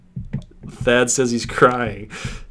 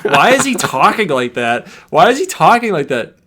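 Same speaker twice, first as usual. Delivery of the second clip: imitating wife; 5 semitones higher, 6 dB louder than usual. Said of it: "She laughs".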